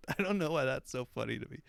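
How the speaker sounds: ordinary talk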